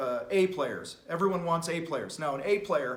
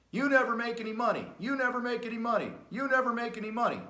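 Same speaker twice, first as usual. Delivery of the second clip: higher, quick mock nagging; nagging spouse; 6 semitones higher, faster than usual. Spouse jumping on the broke business owner, mock high-pitched nagging. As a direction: mimic a nagging partner, the same high tune each time. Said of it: "whether they sound like that or not".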